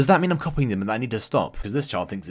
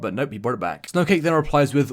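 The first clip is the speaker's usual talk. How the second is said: usual speaking voice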